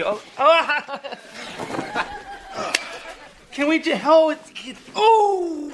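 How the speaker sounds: helpless high-pitched groaning under pressure; pained massage yelps. Acted. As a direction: cry out as the masseur digs in. Massage pressing on sore spot.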